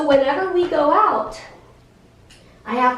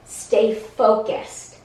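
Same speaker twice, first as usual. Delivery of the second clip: slow command; 5 semitones lower, much slower than usual. Real, not acted.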